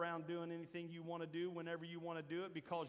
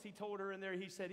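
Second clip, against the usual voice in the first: normal talking voice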